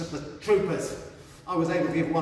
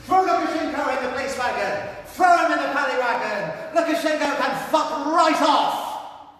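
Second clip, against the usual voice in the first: loud protest yell; shouting at the president; 8 semitones higher, 7 dB louder than usual. Acted. Angry crowd member shouting at the president, loud furious yell. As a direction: bellow it at him from the crowd.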